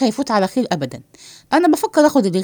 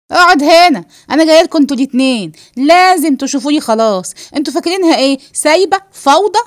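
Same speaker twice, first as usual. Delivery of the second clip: loud, raised confrontational demand; angry command; 4 semitones higher, 6 dB louder than usual. Acted. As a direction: lay down the law loudly, ending on an indignant question. Woman orders the men to stay. (أمر غاضب بصوت عالي)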